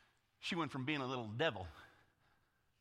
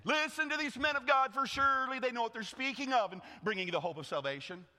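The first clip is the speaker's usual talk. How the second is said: calling out; 7 semitones higher, 3 dB louder than usual. Acted. Slave girl crying out in crowd.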